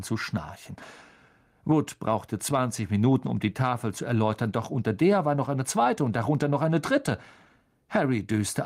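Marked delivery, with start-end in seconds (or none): none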